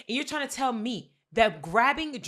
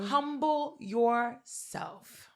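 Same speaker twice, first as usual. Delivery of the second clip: slow drawn-out; much slower than usual. Retold or imitated. Repeats slowly for emphasis.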